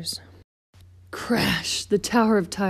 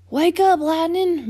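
high, loud, drawn-out wake-up call; slow, high-pitched yell; excited shout; 11 semitones higher, 6 dB louder, slower than usual. A narrator's reading. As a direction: holler to rouse a sleeping friend. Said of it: "Mater shouted".

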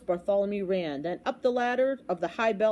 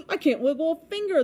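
gasp, then high loud wail; 8 semitones higher, 5 dB louder than usual. A narrator's reading. Trumpeter's arm is stuck, wailing. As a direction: wail in panic. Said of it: "the trumpeter wailed".

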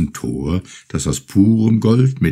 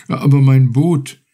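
a hint of worry, a touch louder; doubtful fisherman; 7 semitones higher, 6 dB louder than usual. A narrator's reading. Fisherman worries about leaving his boat, mildly doubtful. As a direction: hesitate over the boat, lightly concerned. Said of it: "meinte Urashima bedenklich".

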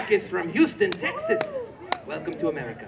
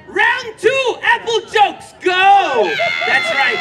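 hype shout; 10 semitones higher, 6 dB louder, much slower than usual. Real, not acted. Host hypes the crowd.

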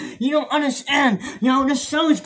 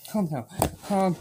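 calm down said low and much softer; 7 semitones lower, 9 dB quieter than usual. Acted. Host quietly pleading with castmate to settle, soft. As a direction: murmur it, almost giving up. Stopping a fight on set.

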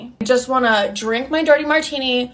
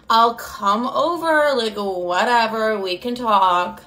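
drawn-out, reluctant whatever tone; grudging agreement; slower than usual. Retold or imitated. Agreeing to meet her ex, slow and grudging. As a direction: drag the words out slowly, unbothered.

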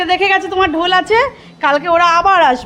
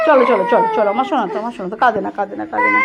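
hushing; 8 semitones lower, faster than usual. Real, not acted. Mother hushing crying son.

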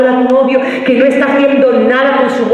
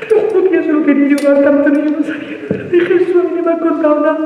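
higher, whiny tearful voice; mock tearful plea; 7 semitones higher than usual. Girlfriend pleading tearfully to her boyfriend, higher and whiny. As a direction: whimper it like you're about to cry.

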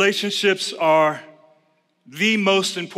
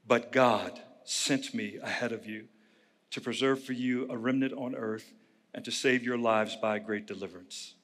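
soft, measured Bible reading; scripture reading; 7 semitones lower, 9 dB quieter than usual. Acted. Pastor reading Genesis aloud to the congregation, soft and measured. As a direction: read the passage quietly to the congregation.

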